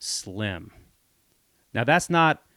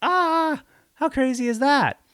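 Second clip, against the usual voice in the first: way higher, amazed exclamation; 4 dB louder, slower than usual. Real, not acted. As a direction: marvel at the coincidence. Reacting to offer for domain.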